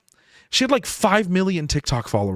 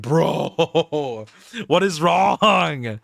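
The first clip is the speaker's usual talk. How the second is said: long drawn-out, wailing disbelief; mock exasperation; much slower than usual. Creator reacting to his own dull recording, mock exasperation. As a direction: stretch it into a comic groan. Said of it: "and it's like".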